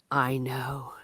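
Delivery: soft whispered reply; whispering. Retold or imitated. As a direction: breathe it out quietly. Whispered quote in retelling.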